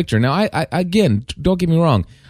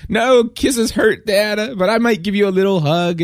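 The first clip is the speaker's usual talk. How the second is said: high-pitched imitation of a small child; joking little daughter; 9 semitones higher than usual. Little daughter refusing dad a kiss, joking. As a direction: play a kid teasingly refusing, voice pitched up. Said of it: "And she's joking around".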